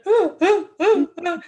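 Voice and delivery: high, loud hiccuping mock sobs; hammed-up gasping cry in bursts; imitated sobbing. Recruit answering drill sergeant, imitated loud blubbering. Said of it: "I just broke down and cried".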